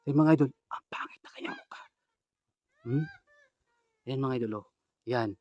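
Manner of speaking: secret whisper to viewers; whispering to the audience. Real, not acted. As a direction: keep it hushed, she's close. Hiding, telling viewers softly.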